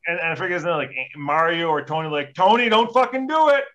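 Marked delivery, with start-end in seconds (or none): 2.3-3.6 high, loud warning shout; imitating a friend yelling stop; retold yell. Bob yelling at the old guy.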